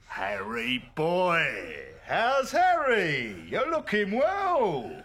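slow, drawn-out, rising delight; old friend greeting the sick husband, slow and gushing; effusive greeting. Acted. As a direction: stretch each word out with joy.

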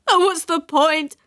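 high, drawn-out despairing wail; sudden wail. A narrator's reading. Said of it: "Wailed Jenny suddenly".

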